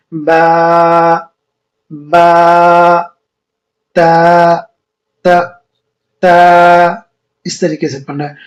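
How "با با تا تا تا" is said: با and تا said long and loud with open a; correct letter sounds. Shown as a demonstration. Teacher shows how to read با and تا, not بے. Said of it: "مثلاً کیسے پڑھنا ہے؟".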